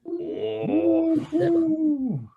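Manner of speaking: long, high-pitched "ooh"; drawn-out whoop at a big roll; impressed ooh. Real, not acted. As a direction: react with high, impressed delight. Reacting to a high attack roll.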